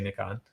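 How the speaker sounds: Nikon said as NICK-on; British pronunciation. Shown as a demonstration. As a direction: borrow the British short "i" in Nikon. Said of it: "I like how they say".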